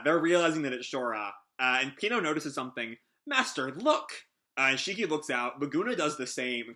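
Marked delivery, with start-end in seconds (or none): 3.2-4.3 high-pitched, drawn-out alert; urgent calling out. Acted. Pino notices something and calls Master, urgent alert. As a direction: call out high to grab attention.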